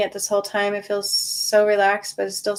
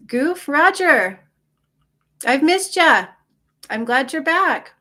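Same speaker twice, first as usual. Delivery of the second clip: delighted greeting; 8 semitones higher, 5 dB louder than usual. Real, not acted. Greeting returning viewer Roger.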